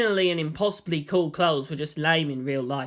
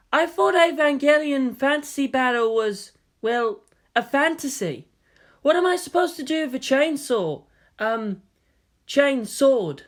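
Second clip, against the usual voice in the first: puzzled Makoto; 8 semitones higher than usual. Acted. Makoto puzzled by chainsaw prop.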